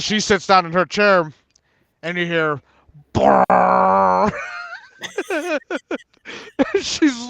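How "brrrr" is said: imitated long fart raspberry; whoopee cushion noise. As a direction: blow a buzzing fart noise.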